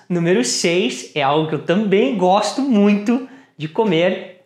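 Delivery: usual speaking voice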